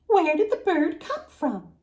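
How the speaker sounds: put-on high, curious little girl. A narrator's reading. Little girl asks the blind woman again, high and curious. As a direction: ask it like a sly child.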